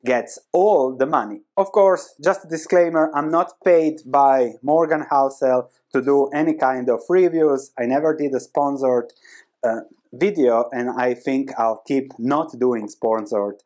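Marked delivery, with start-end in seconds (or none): none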